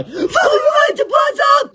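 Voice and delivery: very high, slow, loud shriek; terrified scream. Acted. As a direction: girls fleeing in horror. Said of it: "screamed the cheerleaders".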